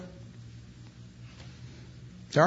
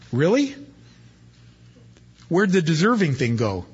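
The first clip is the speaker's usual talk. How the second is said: mock surprise; 4 semitones higher than usual. Real, not acted. Preacher mocks deserving idea.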